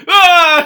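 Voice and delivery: shrieking, high and loud; high scream. Retold or imitated. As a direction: scream in fright, laughing. Imitating the film characters screaming.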